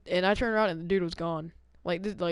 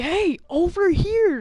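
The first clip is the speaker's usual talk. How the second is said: high, loud, slow stretched call; imitating a stranger's insistent yell; drawn-out yell; 11 semitones higher, 8 dB louder, slower than usual. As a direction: bellow it across the parking lot. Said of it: "he's like".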